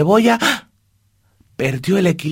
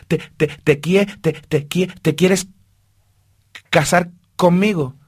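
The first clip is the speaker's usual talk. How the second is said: nervous stutter, higher and louder; stuttering proposal; 5 semitones higher, 4 dB louder, slower than usual. Acted. Shy mouse stammering as he proposes to Martina. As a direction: stumble bashfully into the question.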